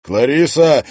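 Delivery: loud, high cry of the name; entranced calling. A narrator's reading. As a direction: call out to her as if in a trance. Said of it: "словно в трансе повторял её имя".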